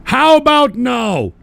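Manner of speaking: high, loud, stretched-out yell; shouted refusal. Real, not acted. Host turning down a donor's request, shouted refusal. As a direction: holler it slowly, dragging out each word.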